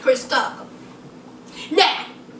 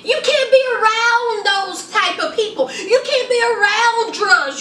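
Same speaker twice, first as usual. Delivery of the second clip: imitating upset Angela; 6 semitones higher than usual. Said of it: "She was like".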